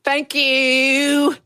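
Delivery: a hint of [name]'s speaking voice, sweetly delivered. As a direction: guest introduces herself with a mild [name] impression. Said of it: "that's my [name] impression".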